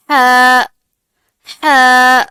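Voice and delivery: ہا from the mid throat, loud and clear; soft thin ha. Shown as a demonstration. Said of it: "اس کو باریک آواز میں پڑھنا ہے، بہت سافٹلی". (باریک آواز میں ہا)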